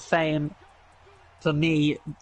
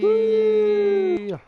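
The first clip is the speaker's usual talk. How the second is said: excited cheer; 7 dB louder than usual. Real, not acted. Cheering as show returns.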